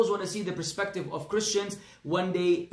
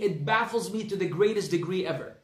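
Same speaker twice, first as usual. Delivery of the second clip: ordinary talk, nothing marked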